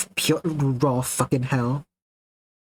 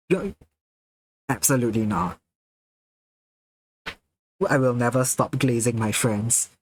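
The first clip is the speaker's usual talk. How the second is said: ordinary talk, nothing marked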